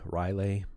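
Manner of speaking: "Riley" said as RYE-lay, trying it out; variant pronunciation. Shown as a demonstration. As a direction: say "Riley" with a long "eye" first, then "lay". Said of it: "Everybody pronounces it differently".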